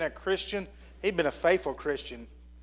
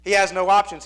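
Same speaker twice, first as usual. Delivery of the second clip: He has no options said louder, pressing the point; louder mock objection; 3 semitones higher, 8 dB louder than usual. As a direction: push the skeptic's He has no options harder. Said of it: "and you say".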